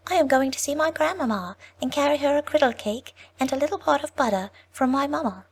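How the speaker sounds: put-on high, light little girl voice; a sweet child answering the wolf. Little girl telling the wolf her errand, high and sweet. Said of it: "said to him".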